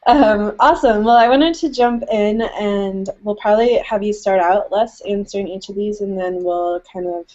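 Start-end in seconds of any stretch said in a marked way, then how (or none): none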